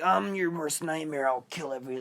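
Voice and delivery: hammed-up spooky clown voice breaking into a growl; scary clown threat. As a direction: imitate a scary clown menacing the camera. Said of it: "like, it's scary".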